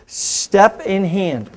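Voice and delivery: drawn-out, slow instructional count. Shown as a demonstration. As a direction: call out the move slowly for students to follow. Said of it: "do it by my count slow".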